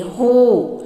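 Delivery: ہو said open and light, not from the throat; open hu sound. Shown as a demonstration. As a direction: let ہو come out easy, unforced. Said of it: "ہو نکلے گی".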